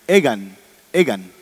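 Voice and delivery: egan, egan said apart and stressed, falling; stressed rhyme ending. Shown as a demonstration. Teacher stressing the rhyme for the class, egan said firmly. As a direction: punch the rhyme egan both times. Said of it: "Terminan en".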